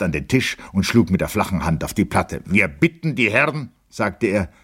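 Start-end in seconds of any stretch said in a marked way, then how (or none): 2.4-4.5 higher-pitched formal demand; firm request. A narrator's reading. Captain asks the gentlemen to speak German, firm request. Officer firmly addresses rowdy guests.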